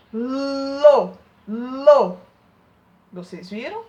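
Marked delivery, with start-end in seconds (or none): 0.1-2.2 loud, exaggerated lo with the tongue tip on the teeth; sounding out lo. Shown as a demonstration. Teacher showing second graders the L sound, loud and exaggerated. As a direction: belt out lo so the class hears the L. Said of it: "quando o L está no início de uma sílaba".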